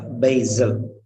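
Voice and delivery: "basil" said "BAY-zul". Shown as a demonstration. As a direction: buzz the S of "basil" as Z. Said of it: "will be pronounced as Z".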